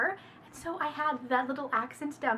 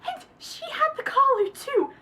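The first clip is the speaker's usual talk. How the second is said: very high, loud laughing shriek; horrified laugh; 5 semitones higher, 6 dB louder than usual. Real, not acted. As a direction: laugh through the horror.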